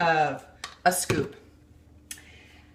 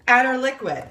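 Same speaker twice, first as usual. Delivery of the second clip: usual speaking voice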